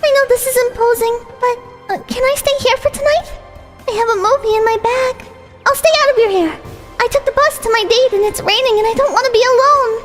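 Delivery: put-on high sweet girlish voice; bright, breathy young girl; high cute anime girl. Voiced female character.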